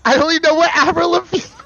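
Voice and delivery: very high, loud, fast outburst; excited shriek. Real, not acted. Reacting to chat with a high, loud, fast squeal. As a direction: blurt it out in a squeal.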